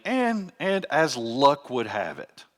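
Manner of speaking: wry, as if it were pure chance; ironic. Acted. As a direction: play up the supposed luck, drawn out. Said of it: "said in the sense of irony".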